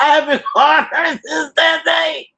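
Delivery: high, squeaky-pitched laughing confession. Real, not acted. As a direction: laugh helplessly through the admission. Laughing about avoiding her cousin.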